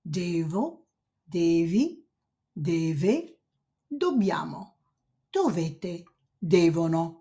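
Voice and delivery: each form of dovere said slowly in Italian; Italian conjugation demo. Shown as a demonstration. Teacher modelling dovere forms for students. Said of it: "and it goes like this".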